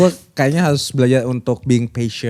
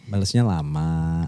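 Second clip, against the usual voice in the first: very low, slow and soft; slow, hushed low-pitched delivery; 11 semitones lower, 5 dB quieter than usual. Shown as a demonstration. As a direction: drop the complaint right down, gently. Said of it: "tone-nya rendah".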